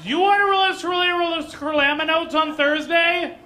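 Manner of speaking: echoing the question across the house; mock confused repeat. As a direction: shout back pretending not to understand. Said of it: "Let me give you an example".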